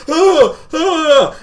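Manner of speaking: two high, loud panicked gasps; scared gasps. Retold or imitated. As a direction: gasp loudly in high-pitched fright. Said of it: "like, really scared".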